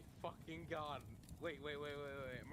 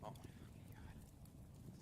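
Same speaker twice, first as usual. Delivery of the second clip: exasperated whisper; 9 dB quieter, much slower than usual. Real, not acted. Listener exasperated at trailer names.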